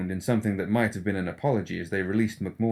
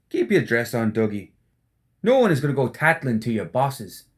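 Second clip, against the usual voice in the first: teasing rogue, a bit louder; Seamus, mocking; 3 semitones higher, 5 dB louder than usual. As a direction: needle his grumpy friend, cheeky. Seamus voiced.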